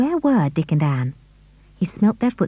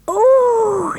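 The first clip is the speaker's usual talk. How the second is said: high delighted cry; girl thrilled at the good news; 4 dB louder than usual. A narrator's reading. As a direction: gasp with joy. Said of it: "her eyes shining".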